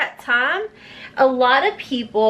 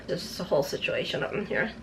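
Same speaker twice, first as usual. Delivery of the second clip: quick, quiet chapped-lips aside; 7 semitones lower, 11 dB quieter, faster than usual. Real, not acted. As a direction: mutter fast through stiff, chapped lips. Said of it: "My lips are chapped".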